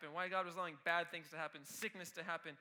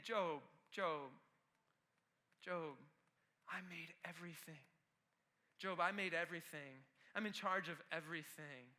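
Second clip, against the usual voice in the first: a hint of God speaking low and soft; lower, softer, calling Job by name; gentle God voice; 4 semitones lower, 5 dB quieter than usual. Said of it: "He's saying".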